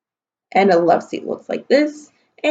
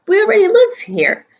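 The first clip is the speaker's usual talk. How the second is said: put-on high, insistent child correcting a grown-up; indignant child; 14 semitones higher than usual. Amelia Bedelia correcting the new neighbor, high and insistent. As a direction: set the neighbor straight.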